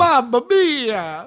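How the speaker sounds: high, drawn-out cartoon Italian cry; mock Italian exclamation. Quoting the end of a pronunciation video, in a hammed-up Italian accent. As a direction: belt it out like a hammy Italian. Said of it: "It ends with Mamma Mia".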